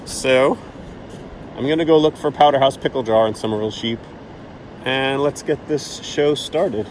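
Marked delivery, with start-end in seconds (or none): none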